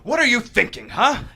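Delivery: loud, high, vicious snarl; savage anger. Acted. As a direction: lash out in fury. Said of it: "his face distorts into savage anger".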